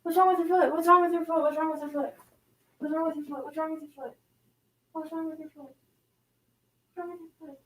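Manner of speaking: high cooing, fading to very soft; worried pet talk. Real, not acted. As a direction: fuss anxiously over a hurt dog. Worried over limping dog.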